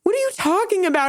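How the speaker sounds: put-on high, fast dismissive boyfriend; mock boyfriend. Boyfriend dismissing her, high and fast. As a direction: brush it off quickly and high. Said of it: "And he was like".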